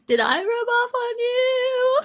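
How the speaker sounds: very high, slow, delighted; excited. Real, not acted. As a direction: stretch it out in glee.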